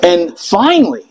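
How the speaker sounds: usual speaking voice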